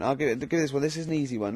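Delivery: ordinary talk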